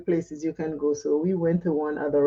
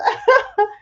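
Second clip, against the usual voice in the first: short high giggle burst; high laugh; 4 dB louder than usual. Real, not acted. Laughing at strangers praising her laugh.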